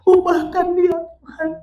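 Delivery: very high imitated crying prayer; tearful pleading. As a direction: wail to God, acting out her plea. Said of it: "Dia nangis". (doa memelas sambil menangis)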